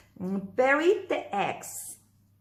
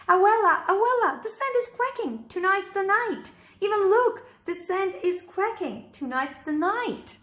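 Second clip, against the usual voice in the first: put-on high, eager child voice; a little boy bursting with excitement; excited little boy; 9 semitones higher, 4 dB louder than usual. Boy Austin excited at hatching.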